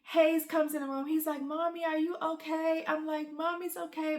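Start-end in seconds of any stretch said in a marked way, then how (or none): 1.4-2.8 higher, lightly voiced little kid; small concerned kid voice; worried child. Voicing her worried child. 3.3-4.2 tearful reassurance. Retold or imitated. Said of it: "but I'm sniffling".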